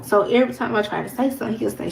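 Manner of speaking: usual speaking voice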